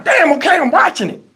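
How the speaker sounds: high, quick exasperated reply; mock fed-up kid being pestered. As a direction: give in irritably, pitch up. Himself, pestered by her tapping.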